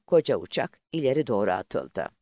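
usual speaking voice

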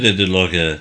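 ordinary delivery, nothing marked

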